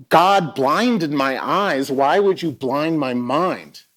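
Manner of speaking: loud, high, slow retold outburst; answering the colleague's church invitation in an angry outburst; sudden rage. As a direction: let the anger burst out, slow and loud. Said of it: "filled with this rage".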